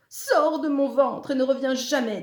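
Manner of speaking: loud, high-pitched furious order; exasperated monster shouting an order; angry command. A narrator's reading. Monstre chasse Zekeye de son ventre.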